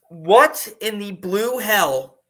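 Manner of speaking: slow, loud exasperation; exasperated disbelief. Real, not acted. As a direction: stretch each word in baffled outrage. Exasperated rant about roster.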